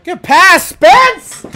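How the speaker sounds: high, loud hype for the pass; hollering at the screen; excited cheer. Real, not acted. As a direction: shout praise across the room.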